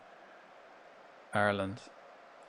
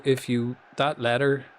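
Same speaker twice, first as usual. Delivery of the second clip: ordinary talk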